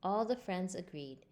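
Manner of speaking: ordinary delivery, nothing marked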